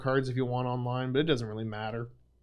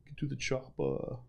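Arnold Schwarzenegger; 7 dB quieter than usual. Pretend. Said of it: "I can't do a good Schwarzenegger".

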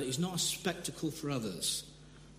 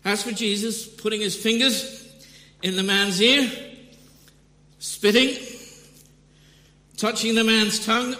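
much louder, higher, building with relish; excited buildup; 7 semitones higher, 10 dB louder than usual. Real, not acted. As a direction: ramp up, relishing each vivid detail. Vivid gospel scene, building up.

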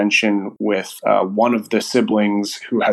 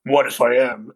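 a hint of a put-on cartoon hero voice; Lego Batman. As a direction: lightly imitate a brooding toy superhero. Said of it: "does the Lego Batman voice".

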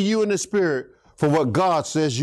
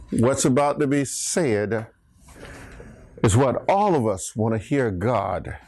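ordinary talk

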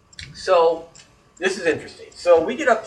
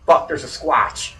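usual speaking voice

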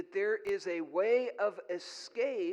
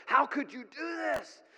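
higher, stern mock outrage; raised, indignant retold question; stern retold demand; 6 semitones higher, 4 dB louder than usual. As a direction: play the appalled husband demanding answers. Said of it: "He asked her very sternly".

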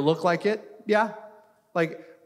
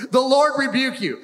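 bold rebuke; 5 semitones higher, 4 dB louder than usual. Shown as a demonstration. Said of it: "Get that into your spirit".

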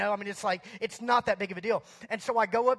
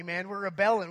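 normal talking voice

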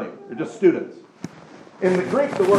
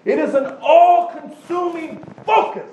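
fervent disciple's vow, pushed higher; fiery commitment; 5 semitones higher than usual. Retold or imitated. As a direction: swear it with fire in your belly. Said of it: "what you're saying is".

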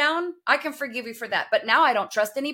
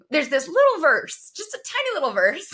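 high, teasing little voice; coyly playing it down; mock minimizing; 5 semitones higher than usual. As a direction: make it tiny and high, playfully sheepish. Mock-minimizing an inconvenient Bible verse.

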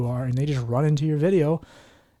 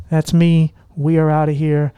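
usual speaking voice

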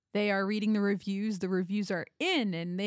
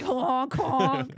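louder goose honking; 5 semitones higher, 5 dB louder than usual. Retold or imitated. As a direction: honk like the goose guy. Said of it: "He's like".

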